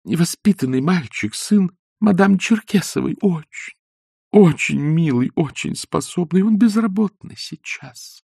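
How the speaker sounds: робкая, выше обычного просьба; проси неуверенно, с запинками; робко. A narrator's reading. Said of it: "сказала она робея". (робко, выше и тише)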